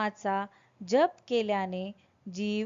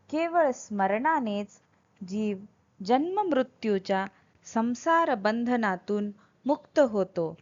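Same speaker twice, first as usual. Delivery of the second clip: normal talking voice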